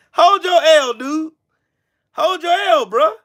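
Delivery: high gloating taunt. Real, not acted. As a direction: rub it in, grinning. Taunting opponent who lost.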